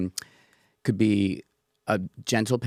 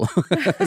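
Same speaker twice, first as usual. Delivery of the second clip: high giggle; laughing sheepishly; embarrassed laugh; 10 semitones higher, 5 dB louder than usual. Real, not acted. Embarrassed about his French.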